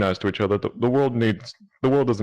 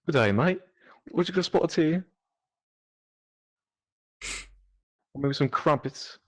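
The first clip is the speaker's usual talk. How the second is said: mock British accent, pitched up; a hammed-up English gent voice; lightly done posh British impression; 8 semitones higher than usual. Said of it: "Do it right quick".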